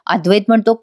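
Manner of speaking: ordinary delivery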